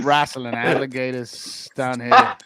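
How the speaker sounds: slow, hammed-up Australian accent; drawn-out Aussie outback bravado. Shown as a demonstration. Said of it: "Crocodile Dundee right there".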